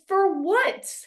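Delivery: high-pitched mock disbelief; incredulous retort. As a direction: imitate a baffled comeback, pitch jumping up. Acted retort in retold quarrel.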